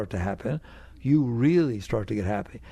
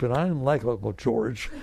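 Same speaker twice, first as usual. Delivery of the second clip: ordinary talk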